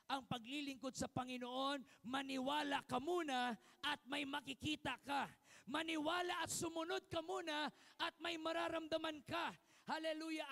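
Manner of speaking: loud, driving sermon climax; full-force preaching. Acted. Pastor urging congregation to believe first, preaching loud. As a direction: hammer the call to believe and obey.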